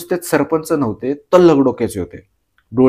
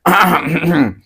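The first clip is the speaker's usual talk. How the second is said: throat clearing; 3 semitones higher than usual. A narrator's reading. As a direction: clear your throat before a speech. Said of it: "एक खाकरा काढून".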